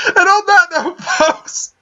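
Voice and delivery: high, breaking with laughter; laughing. Real, not acted. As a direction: try to talk through laughing. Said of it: "unable to speak properly ... laughing".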